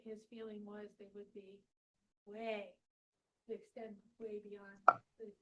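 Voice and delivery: hoarse, nearly voiceless murmur; losing her voice, barely audible; raspy whisper. Real, not acted. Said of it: "I have no voice".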